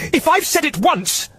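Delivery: loud exasperated, high pitched; about to blow her top; shouting in frustration. Acted. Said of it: "she raised her voice".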